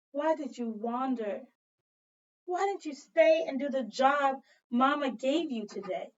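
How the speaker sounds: ordinary talk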